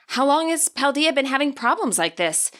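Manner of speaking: plain reading voice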